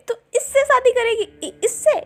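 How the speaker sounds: can't believe she'd pick him; scoffing disbelief. Acted. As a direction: brother scoffing at his sister's groom, disbelieving. Brother scoffs at sister's choice.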